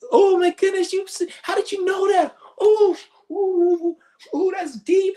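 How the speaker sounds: very high, breathless mock swoon with oohs; swooning schoolgirl. Mocking the host swooning over the aura reader, very high. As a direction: gush like a giddy schoolgirl. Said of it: "getting all wet like a fucking, a schoolgirl".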